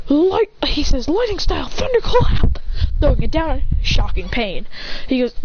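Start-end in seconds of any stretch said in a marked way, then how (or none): 0.1-0.5 high anime attack call, cut off; technique call. Acted. False start of attack call. 1.1-2.6 high-pitched attack call; technique call. Acted. Fighter calls out his technique.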